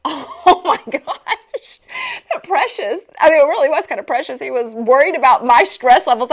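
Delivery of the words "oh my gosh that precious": slow, higher, laughing through the words; laughing, touched. Retold or imitated. Friend asks if she is stressed, she laughs, touched. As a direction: melt at his concern while laughing. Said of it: "I'm like".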